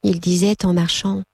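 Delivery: ordinary delivery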